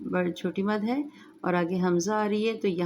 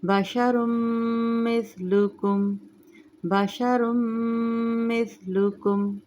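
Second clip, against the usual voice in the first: slow melodic tajweed chant; Quran recitation; 4 semitones higher, slower than usual. Shown as a demonstration. Recites verse.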